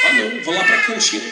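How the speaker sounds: high-pitched whiny mimicry; mock reluctant youngster. Mimics youngster avoiding prayer at church.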